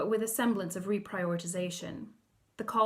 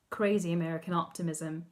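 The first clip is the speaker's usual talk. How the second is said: ordinary delivery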